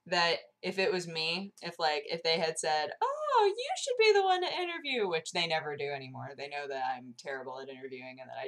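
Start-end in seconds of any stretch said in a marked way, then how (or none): 3.0-5.1 very high, imagined offer; put-on gushing voice. Organizers asking her to interview, put-on high voice. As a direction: leap up into a mock-sweet squeal.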